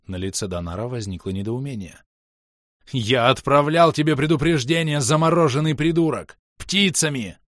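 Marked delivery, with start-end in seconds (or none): 2.9-7.4 high, loud angry retort; exasperated yelling. A narrator's reading. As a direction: yell it at a stubborn foe. Shouted quarrel in battle.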